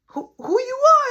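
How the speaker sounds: high, loud mock challenge in an imitated voice; challenging shout. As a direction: throw it back at him like a threat. Retold coworker threatening the narrator.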